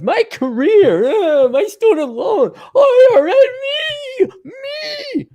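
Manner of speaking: high, slow, whimpering whine; fake-cry it like a spoiled child; mock whining. Mocking career women complaining about loans, high whimpering whine.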